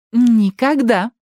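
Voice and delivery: high, drawn-out snide refusal. A narrator's reading. Ali taunts her grumbling companion, snidely. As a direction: say it slowly, high and spiteful. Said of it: "ехидно заявила Али".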